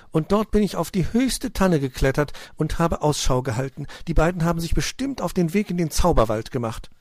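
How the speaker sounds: high, loud lively squirrel voice; squirrel Putzi, eager. A narrator's reading. Squirrel telling of the treetop lookout, eager.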